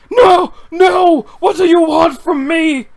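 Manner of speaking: high, loud, desperate shouting; frantic yell. Acted. Sable screams at the ghost duck, loud and high. Comic character yelling at duck.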